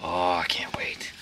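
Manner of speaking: eager whisper. Real, not acted. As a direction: breathe it out, barely voiced. Eager aside after the trauma joke.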